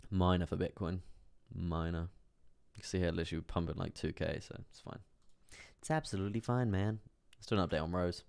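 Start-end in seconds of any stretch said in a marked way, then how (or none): 5.8-7.0 put-on Northern accent. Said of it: "Was that meant to be Northern?".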